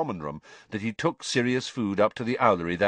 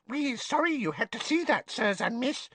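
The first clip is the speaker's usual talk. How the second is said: high, apologetic little elf voice; squeaking house elf. Acted. House elf apologising to guests, high and ashamed. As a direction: squeak it out with bowed head, ashamed. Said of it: "squeaked a nearby elf".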